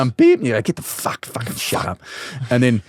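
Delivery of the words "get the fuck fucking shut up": hushed, whispered cursing, acted out; muttered swearing. Swearing at the beeping fridge, muttered irritation. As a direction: curse under your breath in mock irritation.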